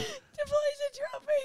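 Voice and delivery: high, slow, soft words through tearful laughter; laugh-crying. Real, not acted. Repeating the forced confession, crying with laughter. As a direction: squeeze the words out while laughing to tears. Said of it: "I feel like I'm actually crying".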